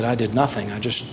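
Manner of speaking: low, subdued confession; ashamed admission. Retold or imitated. Pastor confessing to a church member, ashamed and quiet. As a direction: admit it quietly, head bowed in shame. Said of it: "had to bow his head in shame".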